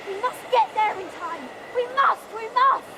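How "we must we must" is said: louder, urgent pleading; desperate cry. Acted. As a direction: push hard, almost frantic. Desperate to reach shelter.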